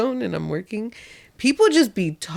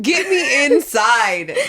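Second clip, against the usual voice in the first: very slow, drawn-out plea as the dog; pleading dog voice; 2 semitones higher, much slower than usual. Retold or imitated. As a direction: voice a dog desperate to get indoors. Voicing the panicking dog's thoughts.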